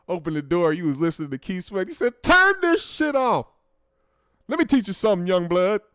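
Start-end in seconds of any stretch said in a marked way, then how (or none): 2.2-5.8 angry dad. Retold or imitated. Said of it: "Your dad was pissed".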